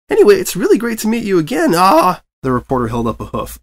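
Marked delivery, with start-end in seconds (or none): 0.1-2.2 high, bouncy chatterbox pony voice; breathless cheerful pony chatter; bubbly Pinkie Pie. A narrator's reading. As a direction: gush it out like an over-eager party pony. Pinkie Pie greets the reporter.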